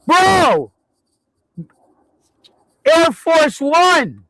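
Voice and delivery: high excited shout, slow on the last words. Real, not acted. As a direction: yell it out in disbelief. Sees the plane overhead.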